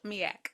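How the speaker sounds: "Miak" pronounced mee-ack, low and falling; "Miak" with an ack ending; odd pronunciation. Shown as a demonstration. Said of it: "Not milk".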